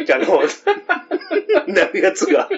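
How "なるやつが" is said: ordinary delivery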